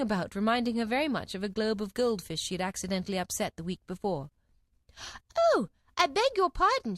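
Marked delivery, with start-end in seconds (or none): 5.5-7.0 high, loud, dismayed apology; great dismay. A narrator's reading. Alice apologises to the toppled jurymen, great dismay. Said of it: "in a tone of great dismay".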